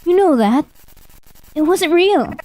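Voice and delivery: high, pleading protest; desperate protest. Acted. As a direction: plead your case to the ghost. Arguing with accusing ghost.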